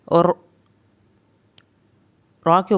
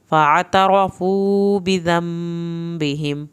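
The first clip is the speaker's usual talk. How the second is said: slow chanted tajweed recitation; Quran recitation; much slower than usual. Shown as a demonstration. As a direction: intone the verses slowly. Teacher recites verses.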